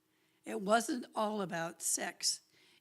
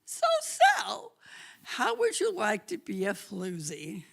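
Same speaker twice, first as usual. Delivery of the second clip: playful invitation voice; 6 dB louder than usual. Retold or imitated. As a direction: tease her into it with a squeal.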